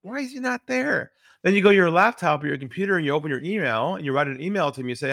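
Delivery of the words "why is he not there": much higher, exasperated disbelief; a salesperson stunned the prospect won't pick up; mock baffled outrage. Salesperson getting voicemail again, mock exasperation.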